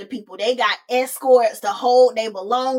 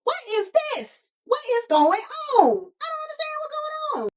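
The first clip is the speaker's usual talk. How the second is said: Y G. Acted. Y G seeing the flower handed over, squeakily bewildered. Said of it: "Y G is like".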